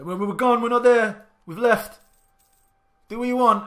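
loud, high fake-innocent call to the rebels; mock innocent. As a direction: pretend you've left, baiting them.

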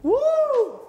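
very high cheering woo; excited whoop. Real, not acted. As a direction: whoop with joy. Cheering after relief.

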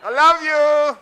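high, loud, laughing I love you; sending love. Pretend. Preacher showing how to send love, high and loud. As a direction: beam love outward with a laugh. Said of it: "Send love".

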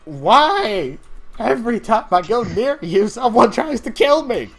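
high, loud, exasperated yelling; furious ranting. Acted. Character accuses someone of nearly killing them, furious ranting. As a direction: wail your grievance at top pitch. Furious rant at the other player.

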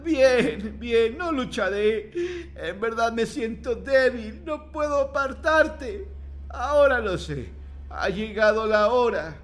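put-on high, slow, feeble old man; resigned, weak old man giving up. A narrator's reading. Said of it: "El hombre con voz débil musita".

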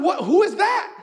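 who could that be, voice jumping up; wondering aloud. Retold or imitated. Mayor spotting a floodlight in the storm, wondering. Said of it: "And I'm wondering".

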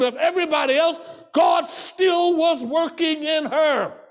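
rising, shouting sermon voice; preaching. Acted. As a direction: preach it out with full force. Preaching at full force.

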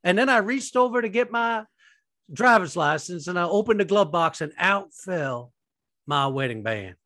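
put-on earnest, swooping televangelist storytelling in a Southern accent; Joel Osteen. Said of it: "That's a really good accent".

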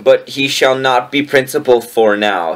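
ordinary talk

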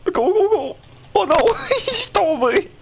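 very high excited yelling; frantic cheering. Real, not acted. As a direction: squeal it in a panic.

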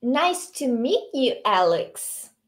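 slow, careful English; slow model reading. Shown as a demonstration. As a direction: model Emma's line slowly. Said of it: "A Emma diz".

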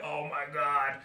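slow, labored mock complaint; mock overheated worker. Men slogging through a sweaty workday, mock exhausted. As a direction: groan it out like a man dying of heat. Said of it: "dying and slugging around during work like".

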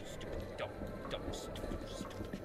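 whispered grumbling under the breath; annoyed whisper. Real, not acted. Grumbling to himself about his dad, whispered. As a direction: hiss the insults quietly, fed up.

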